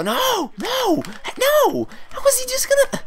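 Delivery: frantic no's rising to a high squeal; panicked shriek. Real, not acted. Gamer watching his fighter get grabbed, panicked high squeal. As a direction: squeal in panic as it slips away. Opponent grabs his arm.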